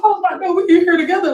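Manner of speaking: high excited recognition. Retold or imitated. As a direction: squeal of sudden kinship. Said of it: "I was like".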